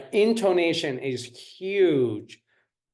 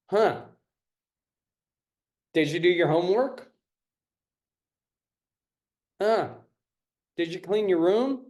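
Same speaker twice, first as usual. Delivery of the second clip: mock accusing parent; 3 semitones higher than usual. Shown as a demonstration. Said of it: "A lot of parents ask to accuse".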